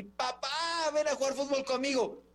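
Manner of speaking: a son's high call to come and play; child calling dad. Retold or imitated. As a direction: call Papá like a little boy wanting to play. Son calls father to play.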